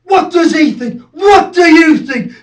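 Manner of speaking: high, loud, aggressive questions; yelled confrontation; shouted challenge. Acted.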